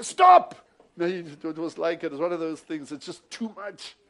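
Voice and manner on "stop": sudden loud high yell of Stop!; imitated shout. Brother Tommy wanting to stop the whipping on screen, shouting. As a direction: burst out like yelling at the screen. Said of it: "wanted to stand up ... and say".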